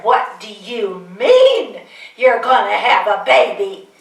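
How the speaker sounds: loud, slow, stunned cry of disbelief; shocked mother. Retold or imitated. Mother stunned by her daughter's pregnancy news, loud and slow. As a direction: spell out each word in shock.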